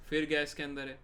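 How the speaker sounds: ordinary talk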